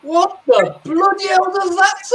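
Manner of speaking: high-pitched mock incredulity; hammed-up bewildered outcry; baffled retold outburst. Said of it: "and she's like".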